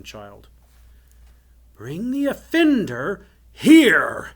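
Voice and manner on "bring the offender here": slow, high shouted order; thundering command. A narrator's reading. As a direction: bellow the order to your guards, drawn out. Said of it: "commanded the enchanter".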